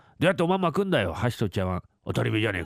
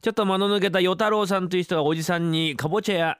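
normal talking voice

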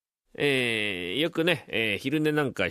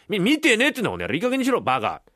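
high, stammered burst of temper; acted husband at the end of his patience; exasperated; 5 semitones higher, much faster than usual. Husband swearing at his wife over the dream, exasperated. Husband snaps in marital quarrel.

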